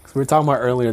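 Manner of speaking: ordinary talk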